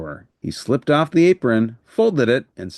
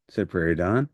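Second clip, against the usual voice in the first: ordinary delivery, nothing marked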